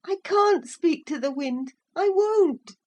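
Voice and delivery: put-on high child voice; a hint of a defiant scared child; stubborn little boy. A narrator's reading. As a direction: refuse in fear. Boy refuses.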